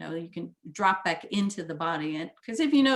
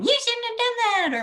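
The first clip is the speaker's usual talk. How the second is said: high sharp blaming retort; mock blaming; 6 dB louder than usual. Illustrating reactive blame, a high mock scold. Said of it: "instead of going".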